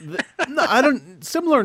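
laughing while protesting; high, laughing denial. Real, not acted. Laughing protest at co-host's remark.

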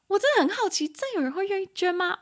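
high, surprised wondering; 高声好奇地问真有人愿意吗; curious disbelief. Real, not acted. Teacher wonders aloud, amused. (老师惊讶又好奇地发问)

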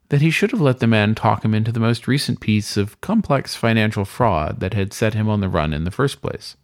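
plain reading voice

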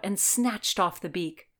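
usual speaking voice

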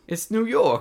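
high, loud mock New Yorker; New York accent. Joking about becoming a New York person, high hammed-up New York accent. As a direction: belt it like a brash New Yorker.

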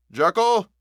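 a high, loud call of the name; loud demanding cry. A narrator's reading. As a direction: call out loud to him through the door. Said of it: "cried Utterson with a loud voice".

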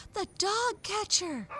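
high, loud exclamation; alarmed cry. A narrator's reading. Raggedy Ann hearing who took Fido, crying out. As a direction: cry it out in high-pitched shock. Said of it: "cried Raggedy Ann".

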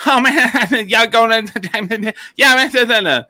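high, loud, laughing mumble of a local; mock an unintelligible Southern accent; laughing, high, loud mock drawl; thick Southern drawl. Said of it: "it literally sounded like that".

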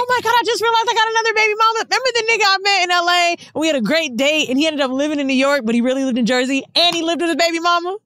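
high, fast burst of sudden realization; excited realization. Real, not acted. To friends, suddenly remembering another baby mama, excited. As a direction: blurt it out in a rush.

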